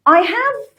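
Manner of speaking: careful, unreduced "I have"; full-form pronunciation. Shown as a demonstration. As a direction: pronounce "I have" in full, uncontracted. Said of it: "ما بنقولهاش I have".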